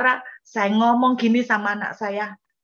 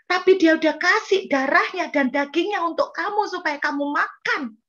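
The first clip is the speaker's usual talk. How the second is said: impassioned preaching; 5 semitones higher than usual. Acted. Preacher retelling a pastor's rebuke to a mother, fervent. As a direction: preach it with rising passion.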